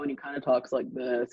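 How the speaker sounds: monotone imitation. Imitating the former CEO's flat monotone. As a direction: mimic the boss's droning voice. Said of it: "kinda has a monotone voice".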